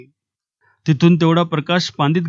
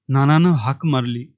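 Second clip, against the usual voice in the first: usual speaking voice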